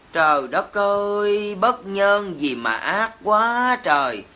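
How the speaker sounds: slow, drawn-out cry of outrage; real appalled exclamation; outraged lament. Real, not acted. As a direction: lament the cruelty, stretching the words. Outraged lament over cruelty to the dog.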